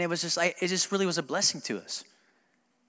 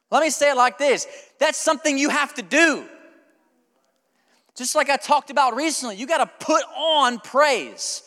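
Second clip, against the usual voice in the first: raised, louder preaching to the congregation; full-force preaching; 9 semitones higher, 6 dB louder than usual. Acted. Pastor tells the church God says endure, in a raised preaching voice. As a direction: pastor preaching his sickness story to the church, loud and fervent.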